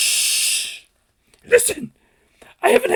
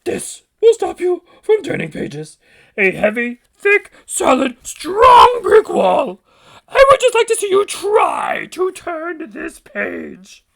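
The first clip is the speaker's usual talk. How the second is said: high Grover rising to a slow loud boast; building to a shout; 6 semitones higher, 10 dB louder, slower than usual. Acted. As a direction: stack each word heavier until you shout.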